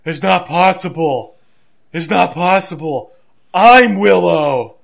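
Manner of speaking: mock frantic cries, building to a drawn-out shout; hammed-up delirious hollering; Jeff Hardy babbling. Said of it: "basically babbling something along the lines of".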